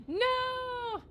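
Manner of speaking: high, sustained no of dismay; dismayed cry. Real, not acted. Gamer reacts to a bad pickup, dismayed high cry. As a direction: wail it out at the loss. Game mishap, streamer cries out.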